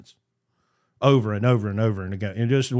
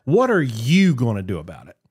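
ordinary talk

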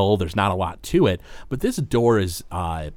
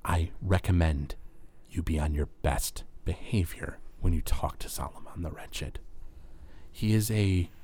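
low, soft, halting caution; grave imp's warning; 5 semitones lower, 11 dB quieter than usual. Acted. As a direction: warn them quietly, with weighty pauses. Imp Belgoroth gives a grave warning.

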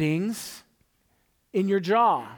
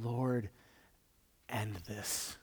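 much softer, lower, spent plea; quiet pained prayer; 8 semitones lower, 12 dB quieter, much slower than usual. Retold or imitated. Man in pain at home praying, soft drained plea. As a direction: beg God under your breath, worn out. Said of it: "literally crying in pain, saying".

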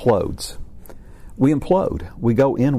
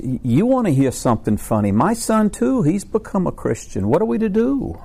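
much higher comic voice, worried at the end; high-pitched joke voice; 9 semitones higher than usual. Acted. Caleb tells Abraham his son converted too, high comic voice. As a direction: pitch it up and ham the worry.

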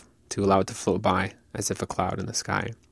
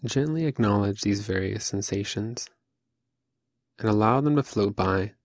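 calm, unhurried meditation voice with long pauses; guided meditation. Acted. Teacher leading listeners through seated Zazen, calm guided meditation. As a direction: guide them gently, phrase by phrase, leaving room to breathe.

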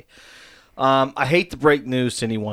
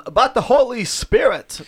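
Will; 10 semitones higher, 3 dB louder than usual. Retold or imitated. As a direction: stretch it out in a goofy impression of a friend. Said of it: "you could do a better impression of me".

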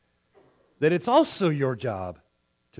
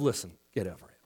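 mock dismissive attitude; 9 semitones lower, 7 dB quieter than usual. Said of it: "We have an attitude of".

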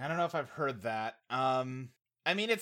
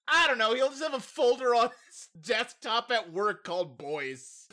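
mock writer pitch; 6 semitones higher, 4 dB louder than usual. Writer answering how to subtly imply it, mock pitch.

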